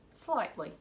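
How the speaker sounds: ordinary delivery, nothing marked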